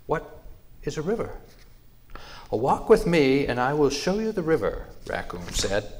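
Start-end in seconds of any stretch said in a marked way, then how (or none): 0.1-1.4 high-pitched, wondering question; curious. A narrator's reading. Mouse wanting to learn from raccoon, curious. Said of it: "Mouse asked curiously". 2.5-4.9 high, louder, eager offer; eager invitation. A narrator's reading. Raccoon inviting mouse to see the river, eager.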